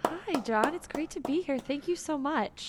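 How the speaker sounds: bright, chirpy TV host greeting; sugary breakfast-show host; morning talk show voice. Acted. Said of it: "a good morning talk show voice. Soothing".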